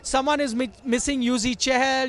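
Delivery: news report. Acted. Reporter's live sign-off report.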